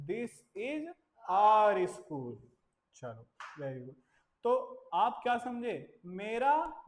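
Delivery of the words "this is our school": loud our for pupils; stress on our. Shown as a demonstration. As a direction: punch our. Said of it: "drill with stressed our".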